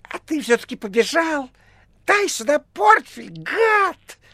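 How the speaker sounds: very high, loud angry squeal; piercing shriek. A narrator's reading. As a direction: screech the demand. Cat-like thug shrieks demands. (пронзительный злобный визг)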